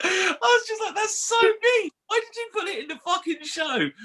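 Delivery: high, tearful laughing protest; real squeaky hysterics; helpless laughter. Real, not acted. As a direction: protest while crying with laughter. Still cracking up at story.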